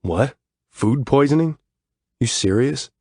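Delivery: disgusted. Acted. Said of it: "Shane sounds disgusted".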